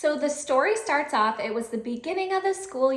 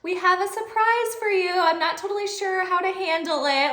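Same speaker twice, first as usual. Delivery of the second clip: higher, teasing surprise; playful announcement; 7 semitones higher than usual. Retold or imitated. Teacher bringing the bunny surprise to the principal. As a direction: announce it with sheepish glee.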